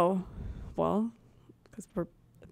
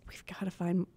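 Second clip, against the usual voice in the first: whispering; 9 dB quieter than usual. Acted. Sudden whisper aside.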